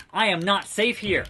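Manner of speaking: normal talking voice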